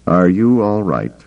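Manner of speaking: usual speaking voice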